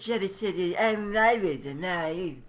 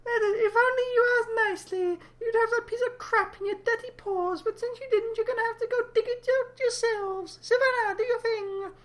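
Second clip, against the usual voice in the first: squeaky character voice. Acted. High squeaky character taunts.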